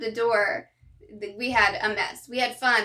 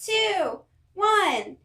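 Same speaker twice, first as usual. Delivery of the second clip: excited countdown; 5 semitones higher, much slower than usual. Acted. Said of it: "Everyone counts backwards together".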